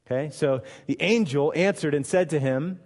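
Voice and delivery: ordinary delivery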